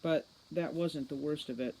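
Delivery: ordinary delivery, nothing marked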